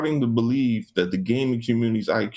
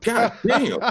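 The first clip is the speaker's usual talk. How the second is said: laughing exasperation; 13 semitones higher than usual. Real, not acted.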